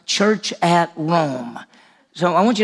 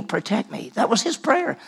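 normal talking voice

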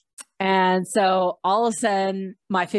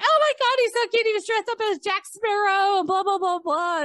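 flustered fangirling; 12 semitones higher than usual. Retold or imitated. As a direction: mimic starstruck women in a high squeal. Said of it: "So they were really flustered".

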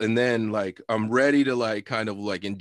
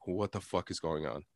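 much softer, low and baffled to himself; muttered disbelief; 5 semitones lower, 11 dB quieter than usual. Retold or imitated. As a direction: say it under your breath in dismay. Retold inner thought, baffled.

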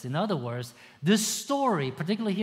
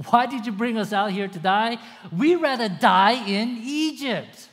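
louder, higher mock whining complaint; hammed-up whining grumble; complaining Israelites; 6 semitones higher, 5 dB louder than usual. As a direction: moan like a sulky crowd. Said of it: "they start to say".

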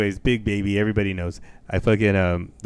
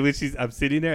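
normal talking voice

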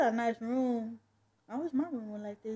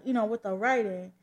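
ordinary delivery, nothing marked

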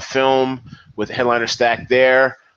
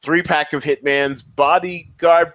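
normal talking voice

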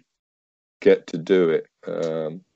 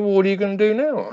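wife's voice; 11 semitones higher, much faster than usual. Retold or imitated. Wife questions him outside.

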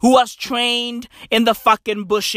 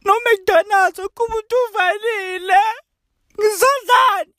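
whiny complainer; 11 semitones higher than usual. Retold or imitated. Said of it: "motherfuckers out there pissed, like".

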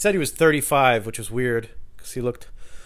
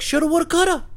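imitating the drunk guy; 4 dB louder than usual.

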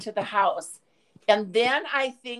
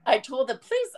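ordinary talk, nothing marked

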